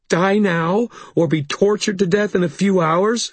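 high, appalled question; distraught challenge. A narrator's reading. Sarissa weighing death against torture, distraught. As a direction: throw it back high and fearful. Sarissa angry at the choices offered.

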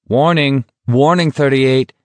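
loud, formal announcement; called warning. A narrator's reading. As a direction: call it out like a guard issuing a warning. Soldier calls out a warning.

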